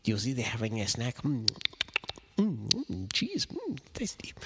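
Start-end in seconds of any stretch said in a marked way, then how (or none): 2.4-4.3 whispered high little voice; mousey nibble. Acted. Reader as the mouse savouring cheese, tiny whisper.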